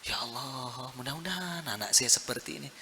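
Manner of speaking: soft, low plea to God, only mildly tearful; retold tearful prayer. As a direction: murmur it like a humble prayer, imitating a weeping father. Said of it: "dia nangis".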